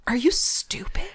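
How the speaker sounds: breathy whisper of disbelief; whispered scorn. Retold or imitated. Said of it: "And I was like".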